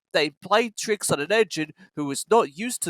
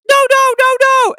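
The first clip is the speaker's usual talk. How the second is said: very high, loud squealing no; defiant trucks; 11 dB louder than usual. A narrator's reading. Trucks refusing to stop, squealing high and loud. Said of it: "answered the trucks".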